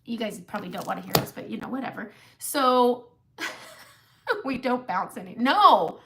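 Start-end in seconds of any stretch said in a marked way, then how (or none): 5.4-6.0 loud, high burst; emphatic denial. Real, not acted. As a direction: blurt it out forcefully.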